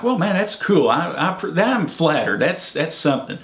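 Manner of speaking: high, swooping re-enacted reaction; flattered surprise.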